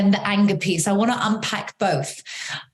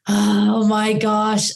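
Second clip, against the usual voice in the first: exasperated; 2 semitones higher, 4 dB louder, much slower than usual. Retold or imitated. Overwhelmed parent in public.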